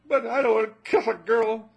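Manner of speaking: put-on high, loud kid's protest; disgusted child. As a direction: recoil like a little boy at the idea. Boy protesting to parent.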